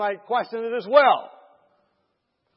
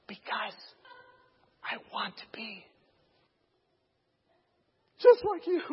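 halting whisper, last words rising; hushed, hesitant repeat; 2 semitones higher, 6 dB quieter than usual. Retold or imitated. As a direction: whisper it in pieces, then squeak the ending. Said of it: "whispering to each other again".

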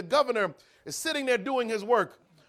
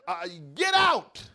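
slow, louder dismissal; firm get out; 5 dB louder, slower than usual. Acted. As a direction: shut him down for good. Prisoner dismisses preacher again.

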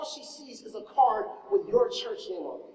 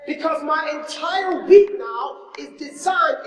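loud, high, slow preaching cry; preaching shout; 6 semitones higher, 6 dB louder, slower than usual. Real, not acted. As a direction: call it out from the pulpit, drawn out.

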